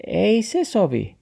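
high-pitched refusal; taxi character. A narrator's reading. Taxi refuses to teach Max, high-pitched protest. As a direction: object in a high little voice.

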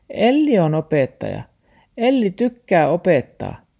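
high, loud dog voice. Acted. The dog tells grandpa, high and loud. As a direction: speak as the small dog.

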